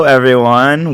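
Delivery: ordinary delivery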